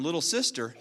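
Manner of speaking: ordinary talk, nothing marked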